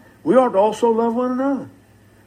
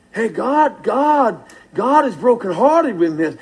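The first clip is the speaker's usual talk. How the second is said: ordinary delivery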